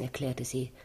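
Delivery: ordinary talk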